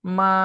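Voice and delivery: মা held long and level; first tone. Shown as a demonstration. Teacher reading the four tones before the break, level first tone. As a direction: sustain an even pitch on মা. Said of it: "চারটা tone বলছি".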